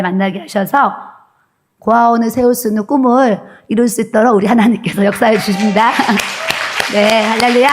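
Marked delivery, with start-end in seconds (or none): 5.9-6.9 brief, soft, airy chuckle; small breath of laughter; real short laugh. Real, not acted. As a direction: let out a quick laugh after speaking. Laughs after joyful announcement to congregation.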